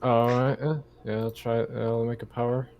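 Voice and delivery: normal talking voice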